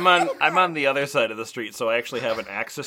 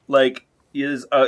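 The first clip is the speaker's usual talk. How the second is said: ordinary talk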